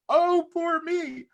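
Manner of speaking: high, slow, drawn-out wail; mock self-pity. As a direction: act out wallowing as a victim. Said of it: "I went into".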